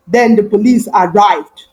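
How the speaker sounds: plain reading voice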